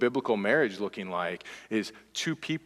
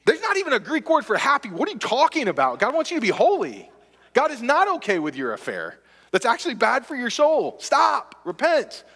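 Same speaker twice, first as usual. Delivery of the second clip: higher, louder rebuke from the pulpit; full-force preaching; 12 semitones higher, 4 dB louder than usual. Acted. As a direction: preach it hard, calling them to repent.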